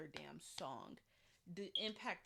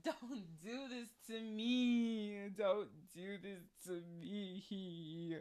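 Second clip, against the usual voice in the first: slow, drawn-out tearful plea; hammed-up begging, stretching every word; mock wailing; 4 dB louder, much slower than usual. Fan pleading over the lip-sync fail, mock wailing.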